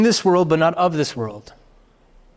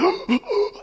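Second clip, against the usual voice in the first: sharp high gasp of shock; mock gasp; 4 dB quieter than usual. Viewer at a killing scene, a high mock gasp. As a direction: gasp like a viewer gripped by the film.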